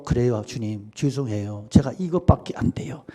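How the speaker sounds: softly voiced, a hint of weeping; quiet, contrite words through mild tears; tearful confession. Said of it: "꺼이꺼이 울면서".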